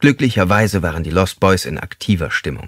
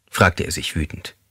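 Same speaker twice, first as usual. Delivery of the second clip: ordinary delivery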